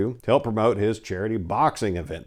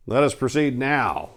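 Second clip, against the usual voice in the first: plain reading voice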